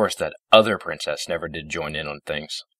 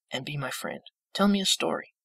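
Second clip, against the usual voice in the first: frail pony, tender; 11 semitones higher than usual. A narrator's reading. Dying Fluttershy asking for a story, high and tender. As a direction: ask sweetly and faintly.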